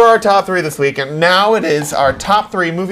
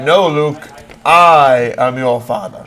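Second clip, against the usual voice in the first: Vader line correct; 5 semitones lower, slower than usual. Retold or imitated. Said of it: "That's how it actually goes".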